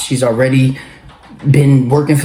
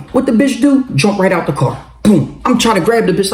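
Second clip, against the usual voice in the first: ordinary delivery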